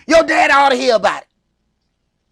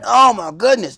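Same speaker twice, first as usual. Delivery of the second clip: ordinary talk, nothing marked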